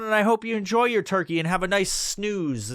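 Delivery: ordinary delivery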